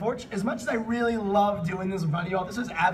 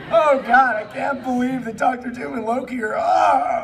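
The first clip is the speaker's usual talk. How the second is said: higher, much louder mock shriek of disbelief; mock horrified reaction; 7 semitones higher, 6 dB louder than usual. Host showing the audience their reaction to smut, mock horrified shriek. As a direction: act out recoiling in horror. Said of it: "us a reaction of".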